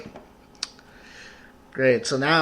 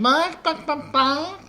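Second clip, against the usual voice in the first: silly barking; 13 semitones higher, slower than usual. Pretend. Silly animal noise joke.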